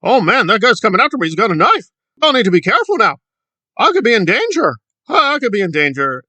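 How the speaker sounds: high, loud melodramatic worry; mock fake fear. Commentator mocking the activist's staged fear, high and loud. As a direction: play up being in danger for attention. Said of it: "trying to cause a ruckus to promote himself".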